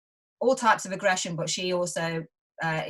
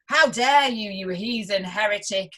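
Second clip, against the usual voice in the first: hammed-up indignant scolding, a touch louder at the end; mock outrage; 5 dB louder than usual. Mocking critics who call her a heretic, mock outrage. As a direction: play the outraged critic, half laughing.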